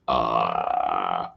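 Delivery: a low, eerie croak from the throat; creepy ghost croak dragged out low; Grudge girl croak. Acted. Streamer imitates the Grudge ghost girl's low croak. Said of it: "that's the girl from The Grudge".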